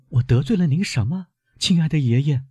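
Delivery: pleading granddaughter, voice lifted high; mild exclamation. A narrator's reading. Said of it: "大声问".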